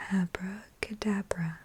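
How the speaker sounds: Abracadabra in a soft voiced murmur; soft-spoken voice. Shown as a demonstration. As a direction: say Abracadabra gently with voice. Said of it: "whisper voice and soft-spoken trigger words".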